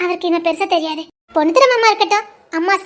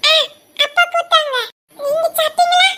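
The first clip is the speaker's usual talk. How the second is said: put-on child voice; 10 semitones higher, 3 dB louder than usual.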